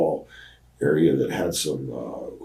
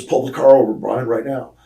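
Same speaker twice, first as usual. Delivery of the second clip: loud, higher barked order to stop the car; retold angry shout; angry demand; 6 semitones higher, 7 dB louder than usual. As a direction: bark it out like a furious wrestler.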